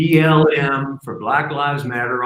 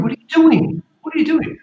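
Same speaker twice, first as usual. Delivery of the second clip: high, shocked yell at the vandal; high outraged shout; kid yelling at vandal; 3 dB louder, faster than usual. Retold or imitated. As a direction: demand it like an outraged young kid. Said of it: "and went".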